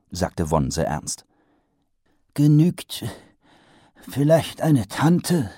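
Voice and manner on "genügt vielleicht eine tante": hopeful. A narrator's reading. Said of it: "erkundigte sich der Patrizier hoffnungsvoll".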